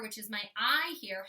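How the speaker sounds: ordinary talk, nothing marked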